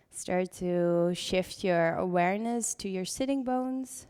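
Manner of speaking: calm voice easing the class into breath; guided meditation. Acted. Leads seated breathing meditation.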